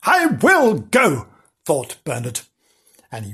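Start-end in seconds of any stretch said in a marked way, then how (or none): 0.0-1.3 high, emphatic resolve; defiant kitten. A narrator's reading. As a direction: announce it boldly, drawn out slowly.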